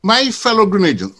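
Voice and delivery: grand stage opening, chest out; thespian voice. Pretend. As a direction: address the nation like a ham actor. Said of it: "go to my thespian voice".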